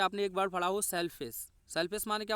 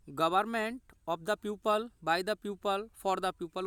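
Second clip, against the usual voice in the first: slow dictation; much slower than usual. Shown as a demonstration. Teacher dictating English definition.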